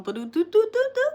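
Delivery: high quick sung phone chime; imitated chime. To viewers, mimicking her phone's yard alert: high, quick chime. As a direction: mimic the notification jingle.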